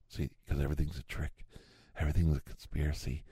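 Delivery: ordinary delivery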